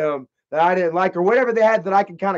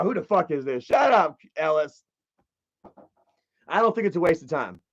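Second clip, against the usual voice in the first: ordinary delivery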